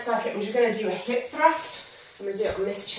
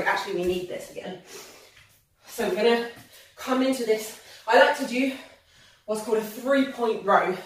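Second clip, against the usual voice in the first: usual speaking voice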